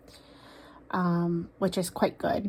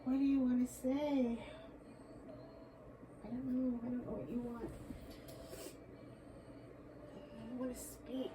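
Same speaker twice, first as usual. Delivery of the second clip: barely-there murmur, much softer; very soft searching murmur; hushed; 5 semitones higher, 14 dB quieter than usual. Real, not acted. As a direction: murmur the question to the unseen spirit. Hushed plea to the spirit.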